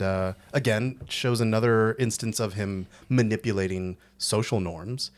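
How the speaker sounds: usual speaking voice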